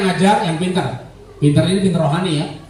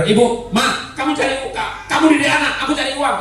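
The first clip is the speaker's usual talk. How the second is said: husband to wife; 7 semitones higher than usual. Retold or imitated. Voices husband dividing chores.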